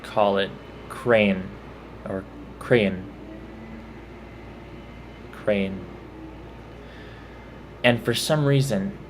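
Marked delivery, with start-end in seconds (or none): 0.9-1.4 "crane" for crayon, one syllable; northern pronunciation. Shown as a demonstration. Mimicking people in the north, crayon as "crane". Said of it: "in the north call it". 2.6-5.8 "crayon" squeezed toward "cray-un", "Crane"; northern variant. Shown as a demonstration. Mimicking northerners, "crayon" run together as "crane". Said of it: "in the north call it".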